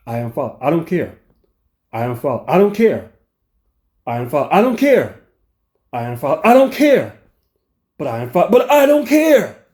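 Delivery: mock rising rage, from calm retort to shouting; escalating anger. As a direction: let every I don't care climb until it is a yell. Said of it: "this is how it goes".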